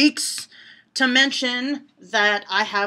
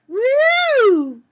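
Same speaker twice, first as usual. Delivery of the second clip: high loud whoop; 8 dB louder than usual. Real, not acted. Reacting to innuendo joke.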